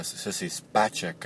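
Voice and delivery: SPACEK said "SPAH-check"; interviewer's pronunciation. Retold or imitated. Caller retelling what the guy said, Spacek as SPAH-check. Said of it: "and the guy goes".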